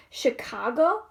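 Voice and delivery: ordinary delivery, nothing marked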